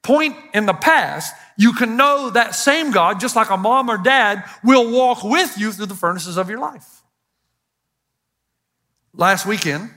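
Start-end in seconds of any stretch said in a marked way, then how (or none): 0.0-7.0 rising, driving sermon delivery; preaching. Acted. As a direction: preach it out with conviction to the crowd. Preaching sermon at full force.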